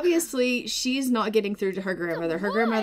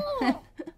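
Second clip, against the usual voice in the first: high laugh. Real, not acted. Laugh after voiced line.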